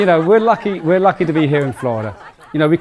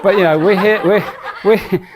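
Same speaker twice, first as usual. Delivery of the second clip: laughing through the words; 4 semitones higher, 3 dB louder than usual. Real, not acted. Host laughing at own joke.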